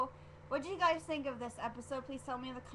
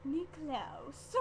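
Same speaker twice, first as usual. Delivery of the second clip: put-on Elijah's accent, drawn out and higher; lightly imitated accent from the show; imitated character accent; 5 semitones higher than usual. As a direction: mimic Elijah's accent on the name. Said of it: "what is with them and their accents".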